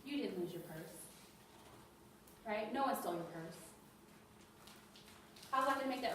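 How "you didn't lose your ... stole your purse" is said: low, softer, understated brush-off; acted calm dismissal of a worried resident; dismissive caregiver. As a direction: play it down, low and quiet. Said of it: "you look at me and say".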